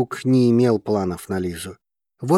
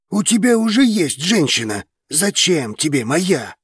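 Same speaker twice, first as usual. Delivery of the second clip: angry growl, much higher; 6 semitones higher than usual. A narrator's reading. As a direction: snarl it, barely holding back. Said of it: "рыкнул Драгос".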